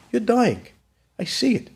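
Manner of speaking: high, insistent retold appeal; earnest plea. Son telling his dying father he sees it, high and pleading. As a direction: press it on him with lifted voice.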